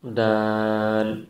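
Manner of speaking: دال with the alif stretched five counts, very slow; long Daal. Shown as a demonstration. Teacher shows the long alif in دال, very slow. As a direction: draw out the aa in دال for five beats. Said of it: "پانچ الف کے برابر کھینچ کے پڑھیں گے".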